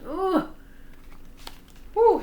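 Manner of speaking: ordinary delivery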